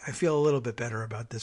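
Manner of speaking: ordinary talk, nothing marked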